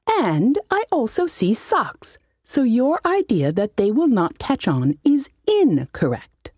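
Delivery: high, put-on voice of a fussy old halfling mage; high-voiced wizard. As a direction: whine it like a pompous little wizard. Wizard lectures demon.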